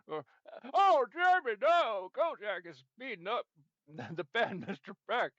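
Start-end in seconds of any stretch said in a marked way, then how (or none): 0.7-2.1 high, loud yelp of alarm; alarmed cry. Acted. Watching Kojak beat up Mister Beck, loud alarmed cry. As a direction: shout in sudden dismay.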